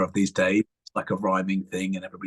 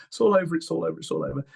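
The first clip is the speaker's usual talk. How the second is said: mock crying; 7 semitones higher, faster than usual. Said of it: "I was crying my eyes out".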